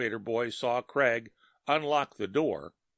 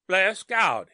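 gasped; 8 semitones higher, 6 dB louder than usual. Acted. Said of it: "he gasped".

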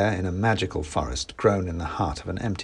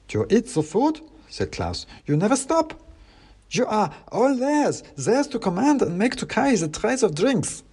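clacking wooden soldier; 12 semitones higher than usual. Acted. Klaus warns the narrator not to eat, clacking. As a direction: warn in a stiff wooden clack.

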